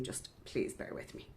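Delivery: usual speaking voice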